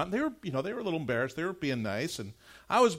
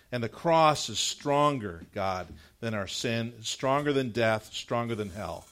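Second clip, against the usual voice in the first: prayer; 4 semitones lower than usual. Acted. Pastor leads prayer.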